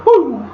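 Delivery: a sharp rising Flair-style woo to cap the quote; Ric Flair woo. Retold or imitated. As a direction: let out a big Nature Boy woo. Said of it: "To quote the great Nature Boy Ric Flair".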